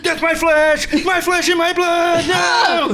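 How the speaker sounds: high, loud anguished cry; dramatic shout. Acted. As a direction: wail it like a tormented martyr. Mock Jesus over transubstantiation.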